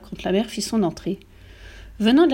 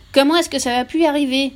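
higher, louder indignant demand; vocifération; 6 semitones higher, 5 dB louder than usual. A narrator's reading. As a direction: bellow the question at the family. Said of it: "vociféra-t-il". (question vociférée, indignée)

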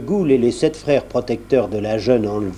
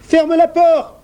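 high piping voice, loud; 6 dB louder, slower than usual. A narrator's reading. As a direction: call it high and thin. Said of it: "une voix se fit entendre".

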